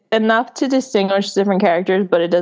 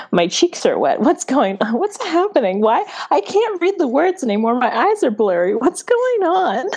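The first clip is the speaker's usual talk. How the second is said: rising higher and higher, bewildered by own tears; mock bewildered crying; 6 semitones higher than usual. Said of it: "you're just like".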